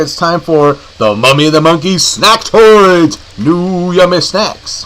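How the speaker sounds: slow drawn-out segment intro; announcer voice. Acted. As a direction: announce it like a show title.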